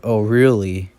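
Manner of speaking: lightly voiced villain, sounding sinister. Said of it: "in a sinister voice".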